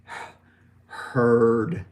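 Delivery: breathy h then heard with held R; h to heard demo. Shown as a demonstration. Teacher building heard from h sound. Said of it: "The H sound".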